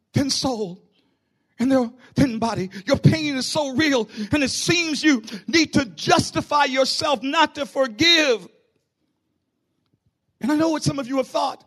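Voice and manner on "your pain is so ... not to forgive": half-sung rising sermon cadence; impassioned preaching. Acted. Pastor preaching about pain, impassioned. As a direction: lift the congregation with it.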